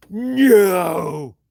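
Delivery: a lightly voiced drawn-out no; exaggerated no. Imitating Luke's exaggerated drawn-out no. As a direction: ham up a slow stretched no. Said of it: "it's all exaggerated and shit".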